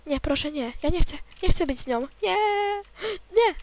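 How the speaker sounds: panicked pleading. Real, not acted. Pleads during danger in game. (błagalnie, coraz głośniej)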